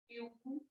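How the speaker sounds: very soft beautiful as view, टी, फुल; hushed three-beat beautiful; broken into syllables. Shown as a demonstration. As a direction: quietly sound out beautiful with pauses. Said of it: "कैसे बन रहा है ये".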